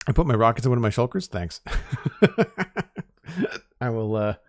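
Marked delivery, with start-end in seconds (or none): 1.6-3.0 breathy laugh going up high; high airy laugh. Real, not acted. Laughs at chat's thanks.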